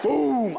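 falling boom; booming footstep. Acted. Comic imitates a star's mighty steps, big boom. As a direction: boom out a heavy step. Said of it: "I can hear his accomplishments in each step".

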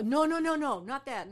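high, loud, rushed no no no; frantic denial. Retold or imitated. Guide frantically correcting Taylor, high and loud. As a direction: wave them off in a panic.

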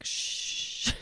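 soft voiceless shush. Retold or imitated. Character hushing the count, whispered shush. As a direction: hush them quietly. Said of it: "And she's like".

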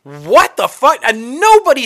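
stunned what-the-fuck, loud; shocked outburst. Real, not acted. Retelling the show's ending to co-host, stunned disbelief. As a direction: blurt it out in disbelief.

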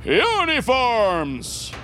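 drawn-out guard's call, high; loud shout. Retold or imitated. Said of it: "with a clear and loud shout".

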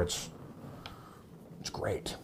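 breathy whisper, barely there; whispered aside. Real, not acted. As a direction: let it slip out in a whisper. Whispered aside about wife.

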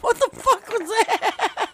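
high, mock-startled shout of "what the fuck"; S0 tells S1 how roommate Corey reacted, high alarmed mock yell; quoting Corey. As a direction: play the roommate bursting in, alarmed. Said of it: "Corey leans in the room and goes".

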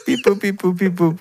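playful imitated beep-boop machine noises; mock computer beeps. Pretending to print from a computer, mock beeping.

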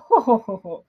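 lightly voiced amused chuckle, mock hearty; a playful "ho ho ho" as an imagined Jesus; Jesus laughing. Preacher imagining Jesus laughing at the disciple, light mock chuckle. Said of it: "I can just imagine Jesus being like".

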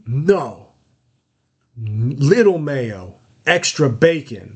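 slow, insistent, a bit angry order; imitating a tense man barking out his sandwich order slowly; forceful customer. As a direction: lay down the order slowly, word by word. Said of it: "'cause he was like".

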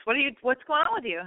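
usual speaking voice

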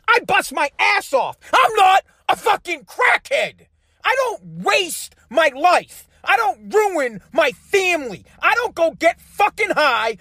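high-pitched, heated outburst; indignant rant. Real, not acted. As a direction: hammer out each phrase in real outrage. Ranting about his tax money.

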